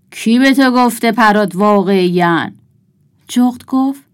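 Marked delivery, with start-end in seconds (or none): 0.1-2.6 loud, challenging wolf voice; wolf character. A narrator's reading. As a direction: challenge the owl loudly as the wolf. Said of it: "گرگ مثل همیشه". (صدای بلند و طلبکار گرگ)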